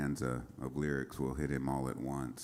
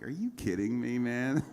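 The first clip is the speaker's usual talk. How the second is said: high, loud are you kidding me; thrilled disbelief at a new chorus; amazed disbelief; 7 semitones higher, 4 dB louder than usual. Retold or imitated. Hearing friend's chorus, cries out in amazed disbelief. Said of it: "I'm like".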